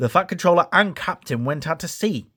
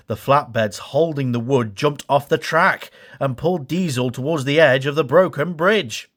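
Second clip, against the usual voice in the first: usual speaking voice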